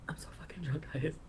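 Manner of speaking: put-on whispered, slurring drunk guy. Retelling her drunk friend's call, whispered. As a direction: breathe it like a drunk caller confessing. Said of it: "And he was like".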